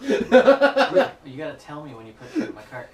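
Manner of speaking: laughing. Real, not acted. Laughing at surprise lantern.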